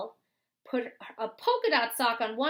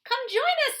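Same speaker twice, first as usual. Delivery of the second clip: put-on high, bright girl's call well above the narrator; Millie calling out; 11 semitones higher than usual. Millie calls Beatrice over from the ice, pitched high. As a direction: sing out an invitation to a friend. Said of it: "said Millie".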